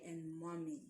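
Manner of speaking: ordinary delivery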